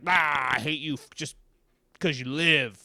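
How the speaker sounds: hammed-up, senseless hatred; mock rage. Imitating someone mad for no reason, mock rage. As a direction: burst out loud on a mock roar.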